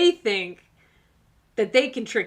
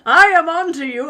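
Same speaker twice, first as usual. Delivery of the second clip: much louder, higher mock shout of "I am on to you"; 4 semitones higher, 10 dB louder than usual. Viewer told to warn their person, hammed-up mock shout. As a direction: bellow it like a cartoon villain caught out. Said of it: "shake your fist at them and say".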